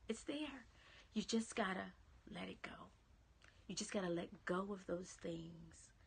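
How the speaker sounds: soft, hushed, near-whispered reassurance; quiet, intimate pep talk falling into a whisper; tender encouragement. Real, not acted. Tenderly encouraging viewers to let go.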